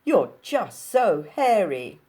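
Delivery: put-on loud, slow Capitol accent; mock-sympathetic Capitol accent; silly Capitol accent. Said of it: "she pipes in her silly capital accent".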